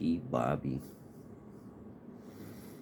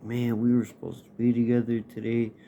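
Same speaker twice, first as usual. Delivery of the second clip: upset complaint; 5 semitones higher, 5 dB louder than usual. Retold or imitated. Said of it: "I'm just like".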